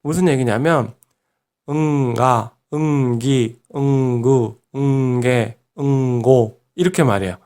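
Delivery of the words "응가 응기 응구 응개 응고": a hummed 응 run straight into each g of 응가, 응기, 응구, 응개, 응고; nasal lead-in. Shown as a demonstration. Teacher shows an easy practice trick, nasal 응 linked into 응가, 응기, 응구, 응개, 응고. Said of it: "응을 넣어서 발음 연습을 해보는 거예요".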